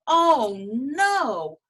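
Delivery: high, loud cry of horror; a stifled cry of dismay; horrified groan. Acted. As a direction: dragon hiding, horrified. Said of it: "groaned the horrified baby dragon".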